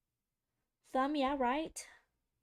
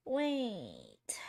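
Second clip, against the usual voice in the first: ordinary talk, nothing marked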